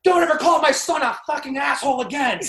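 high, loud furious yell; dad screaming mid-chokehold. Retold or imitated. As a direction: roar it as a warning. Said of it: "And he's screaming".

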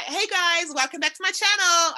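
bright, high American YouTuber greeting; American YouTuber accent. Retold or imitated. Imitating a YouTuber's American accent to her friend. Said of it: "I'd do an English accent, not American".

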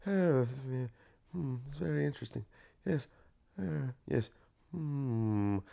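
hushed, murmured listening noises; mock radio interviewer. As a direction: softly murmur along as a rapt interviewer. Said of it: "or they say".

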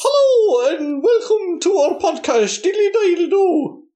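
sing-song mock Irish accent; stage Irish accent. Shown as a demonstration. Host welcomes podcast listeners, hammed-up Irish accent. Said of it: "That's the best Irish accent".

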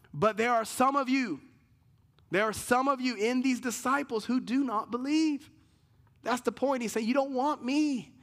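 full-voiced sermon preaching; preaching at full force. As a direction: drive the scripture home to the church. Preaching Jesus' words, raised.

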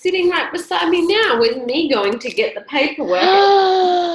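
high, laughing, almost crying with excitement; bursting out a delighted coincidence; excited. Real, not acted. Telling her friend she has the same paperwork, excited and laughing.

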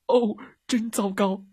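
put-on high, childlike exclamation of dismay; a little pig-like friend cries out in alarm; character cry. A narrator's reading. Little friend spots bear claw marks, high dismayed cry. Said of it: "他喊道".

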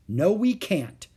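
sharp, loud, slow snap; snapping. A narrator's reading. Older sister snapping at her little sister, loud. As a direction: cut her off hard. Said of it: "snapped Beezus".

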